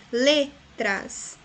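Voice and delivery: letras drawn out, LE-tras, syllables apart; weight on the le of letras; stress on first syllable. Shown as a demonstration. Teacher showing the strong syllable, letras stressed on le. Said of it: "le é a sílaba mais forte".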